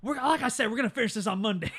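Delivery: loud, high, fast hammed-up threat; mock exasperated shout. Retelling the villain corrected to Monday, mock exasperated shout. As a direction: blurt it out over a laugh, mock-exasperated.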